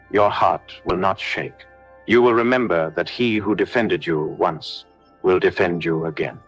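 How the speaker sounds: normal talking voice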